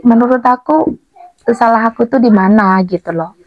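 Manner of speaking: ordinary delivery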